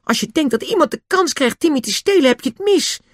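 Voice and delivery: very high indignant outburst; heated, high-pitched defiance; angry and shocked. A narrator's reading. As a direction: challenge it hotly, voice shooting up. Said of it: "George was boos en geschrokken tegelijk".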